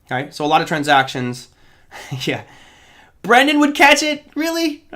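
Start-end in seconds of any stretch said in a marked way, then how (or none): 3.2-4.8 high-pitched, incredulous question; real surprise, voice jumping way up; surprised disbelief. Real, not acted. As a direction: question it as if you can't believe it. Disbelieves a viewer's chat answer.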